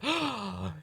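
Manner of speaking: big high theatrical gasp; shocked gasp. Acted. Onlooker seeing a sword drawn, shocked gasp. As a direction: gasp in horror, hammed up.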